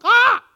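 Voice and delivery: very loud, high caw; piercing high bird screech; raucous raven squawk. Shown as a demonstration. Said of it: "a raucous squawk sounding like ravens".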